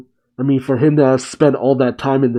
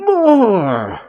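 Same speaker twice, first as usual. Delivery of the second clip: high, drawn-out mock yell; retold yelling; 9 semitones higher than usual. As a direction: yell for it high without full volume. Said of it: "Zoro yelling".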